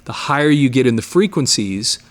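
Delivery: normal talking voice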